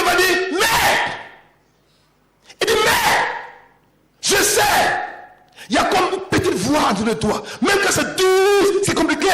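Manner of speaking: high, loud, laughing exhortation; preaching at full force. Acted. Preacher shouting Job's hope to the congregation, exultant. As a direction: lift the church with joyful shouting.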